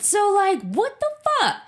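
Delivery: high, loud, laughing disbelief; incredulous outburst. Real, not acted. Venting to a friend about her partner, incredulous and high. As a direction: throw your voice up in disbelief.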